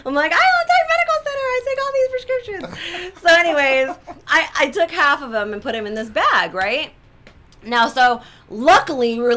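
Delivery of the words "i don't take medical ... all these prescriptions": very high, fast protesting squawk; mock indignant comeback. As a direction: protest it in a high, hammed-up rush. Indignant comeback to airport onlookers.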